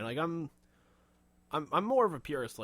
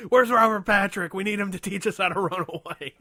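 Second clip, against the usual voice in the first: loud, high cry for help; hammed-up urgent holler; mock frantic calling out; 7 semitones higher, 10 dB louder than usual. Bystander calling for the film's teacher, mock frantic shout.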